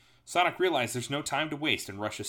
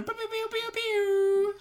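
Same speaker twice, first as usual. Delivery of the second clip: very high pew-pew sounds; laser gun noises; 4 dB louder, slower than usual. Pretend. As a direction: fire off squealed toy laser shots.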